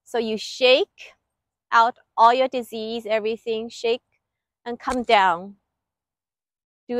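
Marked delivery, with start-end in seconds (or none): none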